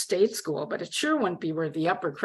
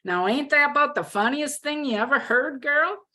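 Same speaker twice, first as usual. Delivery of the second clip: high, hammed-up Texas twang; exaggerated Texas drawl; 8 semitones higher than usual. A narrator's reading. Roommate mocking what they have in common, exaggerated Texas drawl. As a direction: lay on a good-old-boy Texas drawl. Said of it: "she continued in the same accent".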